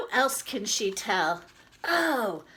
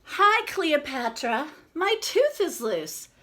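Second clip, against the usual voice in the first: put-on higher, louder kid voice; excited little girl; 7 semitones higher, 8 dB louder than usual. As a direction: announce it proudly like a kid. Girl character tells her friend.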